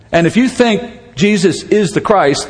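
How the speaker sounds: ordinary talk, nothing marked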